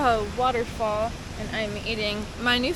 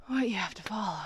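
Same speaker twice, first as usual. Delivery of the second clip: nervous hushed whisper; whispered aside; 2 semitones lower, 7 dB quieter, faster than usual. Real, not acted. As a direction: murmur it under your breath. Whispering on exposed rock.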